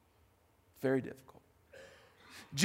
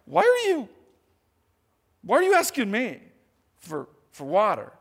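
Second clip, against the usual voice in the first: higher, louder, indignant challenge; pushing back; 5 semitones higher, 6 dB louder than usual. Retold or imitated. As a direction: challenge him, mildly offended. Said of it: "she kind of pushes back".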